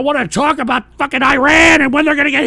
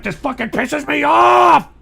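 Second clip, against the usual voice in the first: strained yell swelling on the last word; frustrated outburst. Real, not acted. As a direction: let the anger burst out at the end. Rage at the mess.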